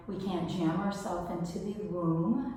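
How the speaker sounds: ordinary delivery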